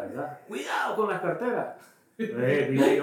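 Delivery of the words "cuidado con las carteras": retold warning. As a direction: imitate the alert in a high voice. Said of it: "puse atención a la alerta". (aviso imitado en voz aguda)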